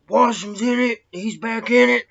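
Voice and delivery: a hammed-up guard shouting a warning; retold yell. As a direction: call out the alarm to the other guards.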